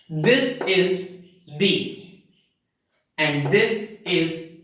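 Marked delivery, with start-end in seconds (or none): 1.6-2.2 "the" with an ee vowel, like "dee"; "the" as thee. Shown as a demonstration. Teacher reads the next transcription, "the" as thee. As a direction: say "the" to rhyme with see. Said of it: "how should we read this phonetic transcription".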